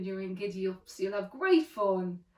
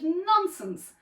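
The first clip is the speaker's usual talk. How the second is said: higher, emphatic motherly dismissal; scolding; 7 semitones higher, 4 dB louder than usual. A narrator's reading. Mother dismisses her daughters' fright, scolding. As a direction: scold them with a swoop on the word. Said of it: "Their mother scolded".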